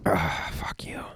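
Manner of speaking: hushed disgusted whisper; whispered curse. Real, not acted. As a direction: breathe it out in disgust. Durian memory, whispered curse.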